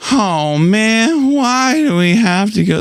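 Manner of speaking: high whiny complaint; mock whining, like a sulky kid. Shown as a demonstration. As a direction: whine like a kid who wants to stay. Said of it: "like, whining".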